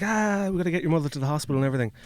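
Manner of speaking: fast, hurried retelling of the aunt; quick mock-panicked aunt; rushed aunt. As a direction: rattle it off like a flustered aunt. Aunt voiced in retold story.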